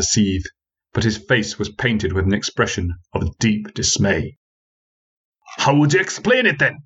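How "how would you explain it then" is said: demanding. Acted. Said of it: "he demanded".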